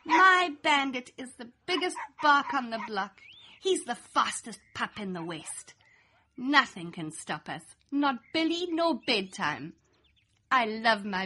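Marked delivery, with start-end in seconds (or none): none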